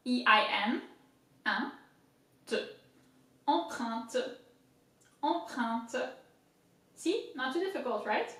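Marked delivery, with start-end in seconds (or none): 1.4-6.1 "un, te", then "Empreinte" joined up; syllables first, then the whole French word; French pronunciation. Shown as a demonstration. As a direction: say "Empreinte" slowly with French nasal vowels. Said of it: "We'll do it again".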